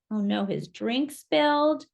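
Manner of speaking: usual speaking voice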